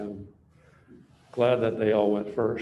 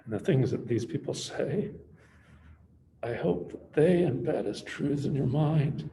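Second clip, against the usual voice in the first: halting, tearful tribute with long pauses; holding back tears. Real, not acted. Speaker honours an admirable young man, holding back tears. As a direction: speak haltingly, fighting not to cry. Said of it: "So much for not crying".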